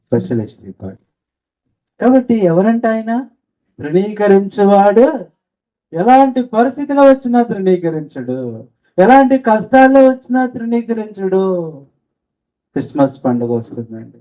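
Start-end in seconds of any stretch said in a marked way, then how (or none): 2.0-11.8 higher, rising preacher's proclamation; fervent preaching. Acted. Preacher proclaiming to the congregation, fervent and raised. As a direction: proclaim it with fire.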